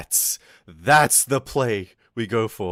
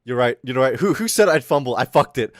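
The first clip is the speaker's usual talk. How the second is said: ordinary talk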